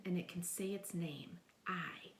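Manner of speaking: soft whispered long I. Shown as a demonstration. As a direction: whisper that it says its name, I. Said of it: "It's wide open".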